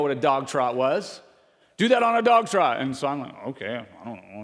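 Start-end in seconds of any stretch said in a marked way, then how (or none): 1.8-2.8 loud, higher boss voice barking an order; mock boss shout, higher and louder; barked order. Boss's barked order retold. 3.5-4.4 much lower and softer, a muttered puzzled reply; lightly voiced clueless kid, low and soft; confused mumble. Young worker's confused reply.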